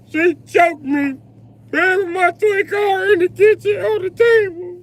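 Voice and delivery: put-on high, slow kid's complaint, near tears at the end; mock whiny little boy. Small son retelling mom choking him.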